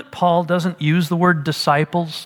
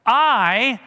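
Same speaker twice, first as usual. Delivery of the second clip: "I" set apart, higher and held; stress on I; 5 semitones higher than usual. Acted. Paul claiming fatherhood of the church, emphatic stress on "I". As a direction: lean hard on "I", pitched up. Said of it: "and he emphasizes the I".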